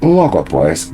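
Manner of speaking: ordinary delivery, nothing marked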